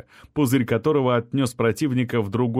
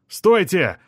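high, loud shout to halt; 7 semitones higher, 5 dB louder than usual. A narrator's reading. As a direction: shout stop at the runners. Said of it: "крикнул Мухин".

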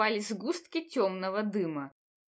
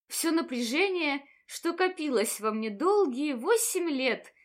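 ordinary delivery

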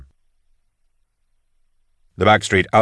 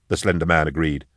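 usual speaking voice

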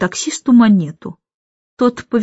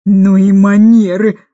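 loud, slow indignant cry; outraged by the crying twins; exclaimed; 6 dB louder than usual. A narrator's reading. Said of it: "воскликнула мисс Эндрю".

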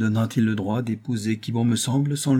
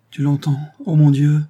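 raised, exalted appeal; sublime fervour; 5 semitones higher, 8 dB louder than usual. A narrator's reading. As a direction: call up to God with fervour, higher and louder. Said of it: "avec une expression sublime". (supplication exaltée)

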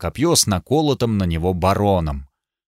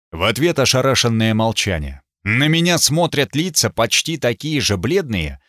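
plain reading voice

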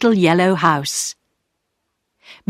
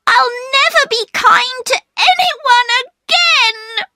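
put-on much higher, tearful child's wail; starting to cry. A narrator's reading. Girl hurt her kindness was refused, crying. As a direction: let the voice break into tears. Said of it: "starting to cry".

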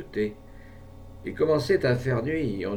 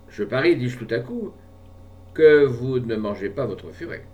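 provoking; 3 semitones higher, 7 dB louder than usual. A narrator's reading. Narrator's provoking bet.

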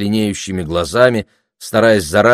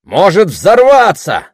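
slow, high, loud protest; irritated; 11 semitones higher, 8 dB louder, slower than usual. A narrator's reading. Heckler warning about the shed, irritated. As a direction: stretch the warning out crossly. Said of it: "продолжал раздражённый голос".

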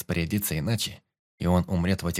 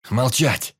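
furious shout, louder; angry shout; 3 semitones higher, 6 dB louder than usual. Acted. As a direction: bark the order in rage. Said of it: "полыхнул гневом юнец".

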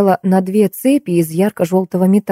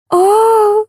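yell. A narrator's reading. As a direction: cry out high and drawn. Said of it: "заорала я опять". (высокий протяжный крик)

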